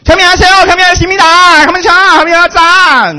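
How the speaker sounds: 很高很大声地学韩语的腔调; 像庆功时大喊般学韩国人说话; mock Korean sounding speech. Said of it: "像我们听韩国人讲话也很像庆功腔". (高声模仿韩语腔调)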